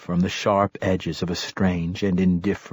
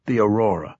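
plain reading voice

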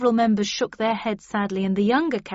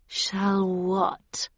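cool menace. A narrator's reading. Heathcliff coolly challenges Edgar's threat.

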